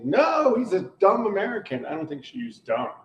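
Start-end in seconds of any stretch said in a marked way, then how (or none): 0.0-1.9 quoting her, high, emphatic exclamation; imitated protest. Co-host retelling what Dee said about him, imitated protest. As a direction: jump up high on a mimicked "No". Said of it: "And Dee was saying".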